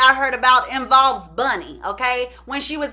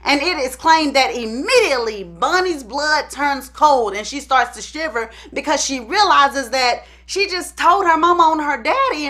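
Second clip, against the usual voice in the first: ordinary talk, nothing marked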